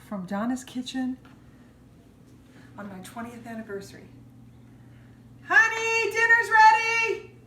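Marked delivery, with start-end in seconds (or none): 5.5-7.3 calling out loud and high; yelling across the room. Real, not acted. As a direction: shout across the house to call him in. Calling husband to dinner.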